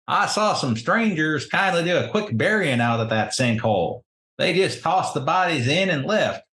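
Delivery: put-on high-pitched rustic old local; old townsman. Old man telling strangers about the sinkhole burial, high rustic voice.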